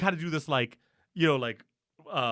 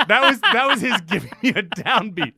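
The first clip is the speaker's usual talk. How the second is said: laughing; 5 semitones higher, 7 dB louder than usual. Real, not acted. Laughing at conductor.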